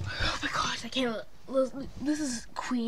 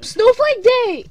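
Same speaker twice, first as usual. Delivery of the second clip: excited cheer; 9 semitones higher, 15 dB louder, slower than usual. Real, not acted. Sister cheers.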